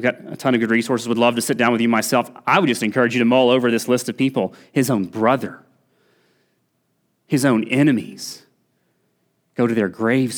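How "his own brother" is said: ordinary talk, nothing marked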